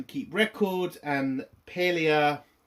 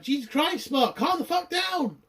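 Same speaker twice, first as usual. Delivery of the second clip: high, rising mock exasperation; exasperated outburst; 11 semitones higher than usual. Telling Mark off for acting like an ex, exasperated. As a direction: cry out in high-pitched disbelief.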